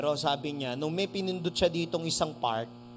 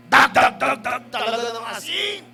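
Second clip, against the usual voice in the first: loud banging "dag dag dag"; loud knocking imitation; 4 semitones higher, 10 dB louder than usual. Pastor recounting the night, loud banging knock sounds. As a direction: hammer out the knocks.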